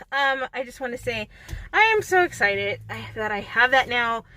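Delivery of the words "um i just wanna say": ordinary talk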